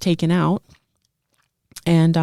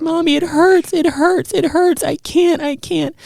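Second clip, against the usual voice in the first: put-on child's pained scream; 10 semitones higher than usual. Son in the bathroom screams to mom, high and pained. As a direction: cry out like a small boy begging for it to stop. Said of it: "he's screaming at me".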